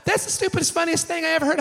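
high-pitched hilarity; put-on high voice, highly amused; imitated amused wife. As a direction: mimic a wife tickled by absurd news. Wife's amused reaction, acted out.

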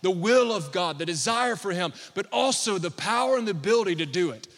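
loud, high preaching declaration; preaching at full force. Acted. Pastor declaring healing over the congregation, full force. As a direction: proclaim it over the church with fire.

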